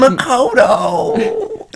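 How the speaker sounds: put-on high, slow whiny old man. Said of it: "I just sound like a whiny old man".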